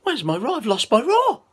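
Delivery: lost roar panic; worried little lion. Acted. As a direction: squeak it out like a frightened cub. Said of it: "He thought".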